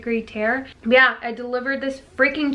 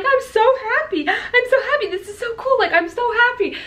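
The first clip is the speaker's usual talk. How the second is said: high-pitched, laughing joy; happy squealing; 13 semitones higher than usual. Retold or imitated. Mom retells what she kept saying, high giddy gush. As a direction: gush like you can't believe it.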